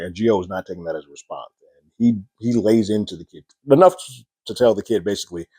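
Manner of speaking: normal talking voice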